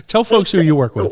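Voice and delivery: laughing through the words; giggling while asking. Real, not acted. Laughs over mixed-up name.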